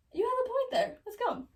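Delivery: very high put-on girlish voice; squeaky-high mock agreement; Juliet giving in. Juliet gives in, acted.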